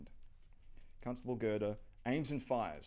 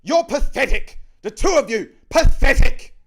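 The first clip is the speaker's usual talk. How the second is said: high, screamed contempt; enraged shrieking rebuke; furious; 25 dB louder than usual. Acted. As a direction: berate them at full volume. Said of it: "She is furious".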